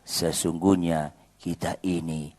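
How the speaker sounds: husband praying about an angry wife; mock prayer. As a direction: tease husbands with a humble prayer. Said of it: "bilang sama Tuhan".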